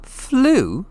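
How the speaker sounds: high incredulous echo; boy can't believe it; surprised Harry. A narrator's reading. Harry repeating Hagrid's word in disbelief.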